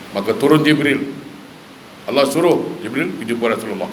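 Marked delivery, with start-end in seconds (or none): none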